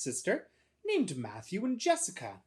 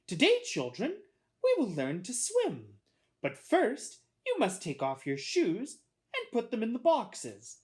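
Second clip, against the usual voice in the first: raised, singsong teacher voice; instructing teacher; 8 semitones higher, 4 dB louder than usual. A narrator's reading. Teacher telling children to remove shoes, raised instructing voice. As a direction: address a class of small children.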